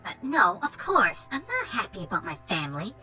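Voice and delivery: put-on spoiled Valley Girl brat; Valley Girl accent. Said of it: "Valley Girl accent going".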